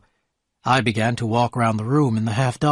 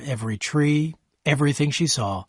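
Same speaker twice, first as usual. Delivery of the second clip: ordinary delivery, nothing marked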